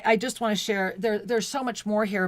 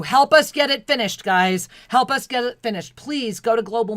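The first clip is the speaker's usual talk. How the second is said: loud appeal; 3 semitones higher, 7 dB louder than usual. Real, not acted. Appeal to donors.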